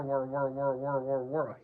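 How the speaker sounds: loud mechanical whir; loud whirring. A narrator's reading. Tigger's loud whirring fight.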